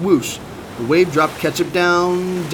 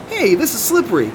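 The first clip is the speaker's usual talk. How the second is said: high-pitched surprised exclamation; excited shout; 8 semitones higher than usual. A narrator's reading. Ketchup slides on the soapy deck, excited shout. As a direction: cry out high, caught by surprise. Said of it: "Ketchup shouted".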